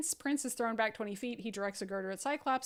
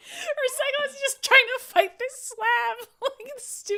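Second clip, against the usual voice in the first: high, loud laughing through words; can barely get it out for laughing; 11 semitones higher, 6 dB louder, slower than usual. Real, not acted. Picturing the slab fighting Cyclops, laughing hard.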